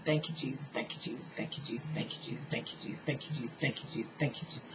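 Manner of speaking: soft rapid whispered thank you Jesus, over and over; whispered prayer. Retold or imitated. Lying face down on the floor, praying in a repeated whisper. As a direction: breathe it out as a murmured chant. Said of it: "And I started saying".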